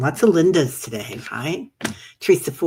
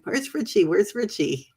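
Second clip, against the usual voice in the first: high-pitched mock searching for someone; playful high-voiced "where are you" call; 8 semitones higher than usual. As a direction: quote yourself looking around, pitched well up. Retells looking for absent viewer.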